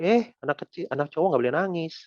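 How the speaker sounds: lightly voiced nagging elder; scolding adult. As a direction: imitate a stern grown-up telling off a child. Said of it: "dari kecil pun suka dibilang".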